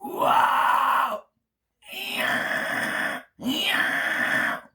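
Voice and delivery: breathy airy whispered screams. Shown as a demonstration. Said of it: "whisper screams".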